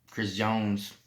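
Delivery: ordinary delivery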